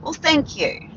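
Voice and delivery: high, sing-song mock-polite thanks; a sweetly pointed thank you; sarcastic thank you. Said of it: "And I said".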